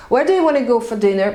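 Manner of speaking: usual speaking voice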